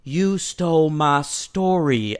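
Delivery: slow, creepy Southern drawl; eerie, drawn-out Southern accent; spooky Southern accent. Acted. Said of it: "the spooky Southern accent".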